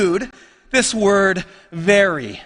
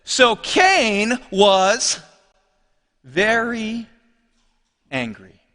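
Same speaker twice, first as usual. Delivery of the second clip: slow scripture reading; 3 semitones lower, much slower than usual. Shown as a demonstration. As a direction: read slowly, pausing between words. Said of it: "Verse five says this".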